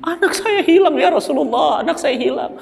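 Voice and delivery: high, pleading cry of a lost mother's grief; distraught mother. Acted. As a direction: beg for the lost child. Mother cries to the Prophet. (ibu meratap, suara tinggi memohon)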